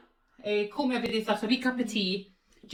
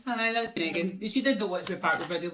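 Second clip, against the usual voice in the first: normal talking voice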